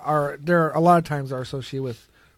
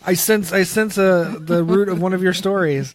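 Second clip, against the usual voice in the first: mock psychic; 5 semitones higher, 7 dB louder than usual. Teasing psychic act.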